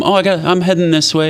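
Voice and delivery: playful pretending. Jesus pretending to leave the disciples, quick and breezy. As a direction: fake leaving with a teasing lilt. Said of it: "he pretends like he's not gonna come".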